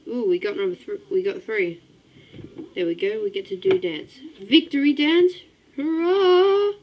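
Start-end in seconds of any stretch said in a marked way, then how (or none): 4.4-6.7 high, loud victory cheer; hooray cheer. Real, not acted. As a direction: shout it out like you just won. Celebrating a win in game.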